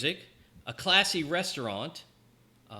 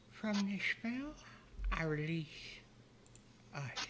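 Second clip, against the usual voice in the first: breathy high whisper; 5 semitones higher, 12 dB quieter than usual. Acted. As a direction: whisper the spell softly. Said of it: "She leans in and whispers".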